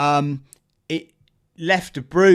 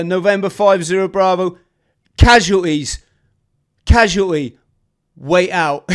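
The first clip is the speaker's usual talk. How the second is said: urgent radio call; 5 semitones higher, 9 dB louder than usual. Retold or imitated. Radio operator reporting casualties.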